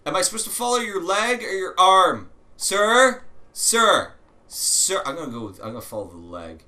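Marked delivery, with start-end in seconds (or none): 2.6-5.0 loud, high call to get attention. Real, not acted. Player calling a stranger in the game, loud call. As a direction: hail him across the room.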